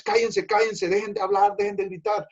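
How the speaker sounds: high, sharp hushing of the crowd. Retold or imitated. Crowd hushing the blind men.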